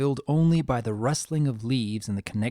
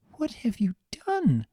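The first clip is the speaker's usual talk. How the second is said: edge of panic; 11 semitones higher than usual. A narrator's reading. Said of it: "panic edging in her voice".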